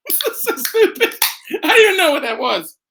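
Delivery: high-pitched, squeaking through laughter; helpless laughing. Real, not acted. Host cracking up at his own silly noise, laughing. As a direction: talk through a real laughing fit.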